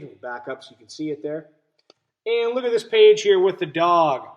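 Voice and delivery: ordinary talk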